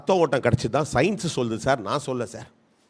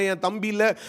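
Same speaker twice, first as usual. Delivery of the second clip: usual speaking voice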